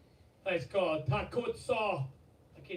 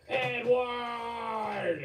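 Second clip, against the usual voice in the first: higher, loud excited call; shouting the name; 6 semitones higher, 7 dB louder than usual. Real, not acted. Streamer calling out donor Edward, loud excited shout.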